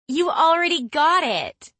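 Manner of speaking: high loud envious outcry; indignant surprise. Acted. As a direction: protest in jealous disbelief. Becky envious of the vest.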